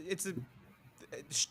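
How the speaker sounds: usual speaking voice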